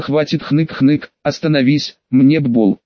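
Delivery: plain reading voice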